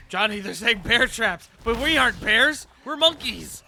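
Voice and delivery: loud, high, slow exclamation; excited. Real, not acted. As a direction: make it a big excited joke. Excitedly joking about bear traps.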